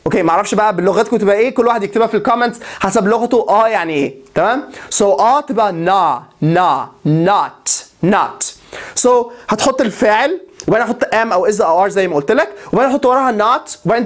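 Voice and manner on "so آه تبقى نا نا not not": not said with an open a like Egyptian آه, nah-t; drawn-out نا then not, open vowel; pronouncing not. Shown as a demonstration. Teacher shows students how to say not. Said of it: "نطقها مش not".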